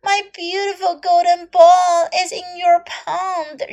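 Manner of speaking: high, pleading princess voice; a storybook princess asking for help; cartoon princess. Princess asks frog.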